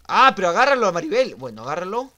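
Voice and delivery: loud, high yell of frustration; urgent shout. Real, not acted. Player urging capture of Maribel, urgent shout. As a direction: shout for someone to grab them.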